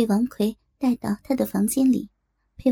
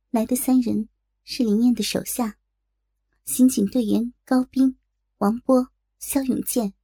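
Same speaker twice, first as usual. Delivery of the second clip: usual speaking voice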